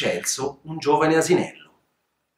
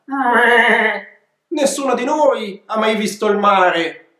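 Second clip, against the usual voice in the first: higher, bleating goat voice, frightened; scared bleating goats; 7 semitones higher, slower than usual. Acted. Goats telling the young donkey, fearful bleating. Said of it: "belarono in coro impaurite".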